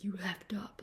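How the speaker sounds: a hushed, mock-menacing warning; whispered threat. Quoting the drunk guy threatening her husband, whispered. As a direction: hiss the threat under your breath.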